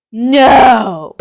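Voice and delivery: anguished no. Real, not acted. Reviewing lip gloss, crying out at a flaw. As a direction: wail it in mock despair.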